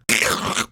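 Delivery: harsh mouth-made crunch; rasped crackle of a tightening grip; crunching sound effect. Acted. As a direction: imitate bones being squeezed. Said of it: "you just hear".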